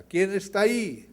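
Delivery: high, loud call thrown back; echoing shout. A narrator's reading. Mountain echoes the boy's question, loud shout. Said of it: "Recibe una respuesta".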